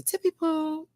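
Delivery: high, slow sing-song voice; silly high drawn-out baby talk; playful high voice. Acted. Playful voice to super chatter.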